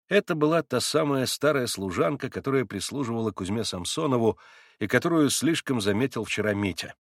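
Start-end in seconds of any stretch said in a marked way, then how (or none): none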